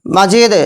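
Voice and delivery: মাজিদ with long i and bounced d; teacher's example মাজিদ, d released; qalqalah demo. Shown as a demonstration. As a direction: stop on মাজিদ, echoing the final d. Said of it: "সাকিনযুক্ত কলকলা".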